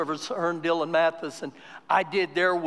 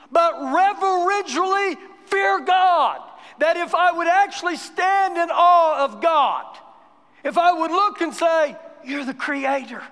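higher, louder sermon at full pulpit force; fervent preaching; 7 semitones higher, 4 dB louder than usual. Acted. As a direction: preach it out, building conviction. Preaching with rising fervour.

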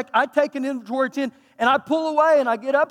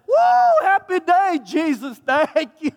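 hammed-up whoop, much higher and louder; mock joyful whoop; 8 semitones higher, 5 dB louder, slower than usual. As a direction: celebrate like you won the lottery. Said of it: "You can say".

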